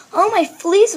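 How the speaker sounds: high, loud wail from a worried mama; swooping, panicky exclamation. A narrator's reading. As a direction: cry out in alarm. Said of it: "wailed Mama Junebug Jones".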